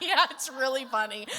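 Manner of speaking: loud reply through laughter; laughing retort. Retold or imitated. Daughter replying to her amused mom, laughing. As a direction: concede the joke loudly, half laughing.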